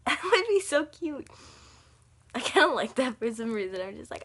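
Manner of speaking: ordinary talk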